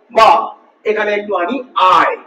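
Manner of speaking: normal talking voice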